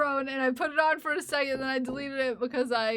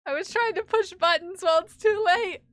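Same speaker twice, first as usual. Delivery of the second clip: higher, louder, fast mock wail; 5 semitones higher, 6 dB louder, faster than usual. Streamer wailing to chat about pressing buttons, mock wail. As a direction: gabble it in playful panic.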